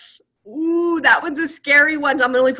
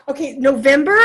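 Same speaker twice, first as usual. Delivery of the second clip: normal talking voice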